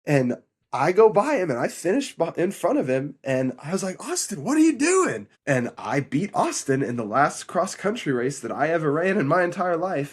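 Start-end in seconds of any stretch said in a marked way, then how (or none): 4.1-5.3 high-pitched incredulous demand; retold exasperated cry. Runner to the brother he just beat, retold exasperated cry. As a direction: act out baffled exasperation, pitched way up.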